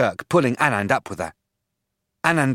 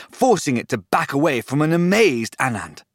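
ordinary delivery, nothing marked

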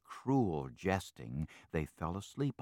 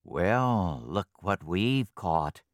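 gloating fox; 5 dB louder, much slower than usual. Acted. Fox captain gloating over catch.

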